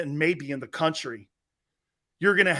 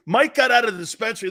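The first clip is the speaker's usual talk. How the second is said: fast, laughing through the line; 4 semitones higher, 6 dB louder, faster than usual. Real, not acted. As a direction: blurt it out while cracking up. Laughing punchline about Mike.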